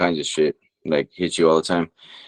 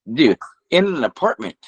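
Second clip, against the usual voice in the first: much higher, incredulous retold outburst; stunned disbelief; 12 semitones higher than usual. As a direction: replay the shock of a shootout indoors. Retold disbelief at the shooting scene.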